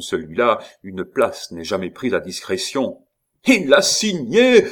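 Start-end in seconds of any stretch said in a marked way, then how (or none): 3.5-4.6 high, loud triumphant howl; full-voiced high shout; yelled. A narrator's reading. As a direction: yell it out for all to hear. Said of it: "hurla Gorenflot".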